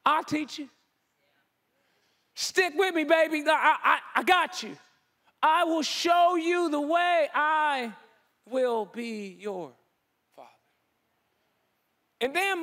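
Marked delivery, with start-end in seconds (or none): 0.0-10.5 God gently promising a frightened Moses; tender God. Retold or imitated. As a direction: comfort a child who's scared. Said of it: "God's going".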